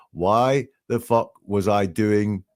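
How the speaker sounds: slow, spaced-out retold question; reluctant, pulling out. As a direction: draw it out as if unwilling to finish. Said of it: "he doesn't want to say it".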